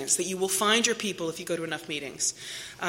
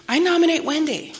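bright nomination; 9 semitones higher, 6 dB louder than usual. Retold or imitated. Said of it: "somebody says".

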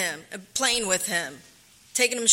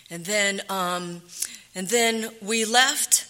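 normal talking voice

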